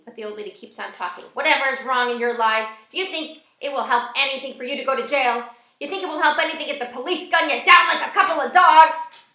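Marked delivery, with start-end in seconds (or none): 1.3-9.2 put-on loud, mean old lady's tirade, building higher; angry scolding that builds to a shout; as if swearing. A narrator's reading. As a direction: spit the words out like curses. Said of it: "Her voice is like she's swearing".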